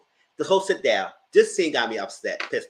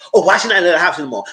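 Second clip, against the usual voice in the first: pushy loud question; 4 semitones higher, 9 dB louder, faster than usual. Retold or imitated. Retold pushy question at table.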